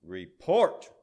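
loud bark, jumping up at the end; barked command. A narrator's reading. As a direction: snap it out like a commander. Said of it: "barked the prince".

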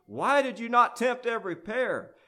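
high, loud accusing question; roared reproach. A narrator's reading. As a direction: roar it, demanding an answer. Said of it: "prince roared".

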